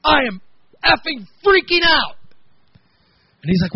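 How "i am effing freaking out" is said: high, loud panicked shout; panicked yell. Retold or imitated. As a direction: yell it like you're trapped in a tunnel and losing it. Panicking friend in tight tunnel.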